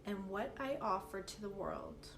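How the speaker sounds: slow, measured meditation guide with long pauses; unhurried, evenly paced calm guidance; guided meditation. Acted. Teacher leading listeners through a meditation for confidence, slow guided-meditation delivery.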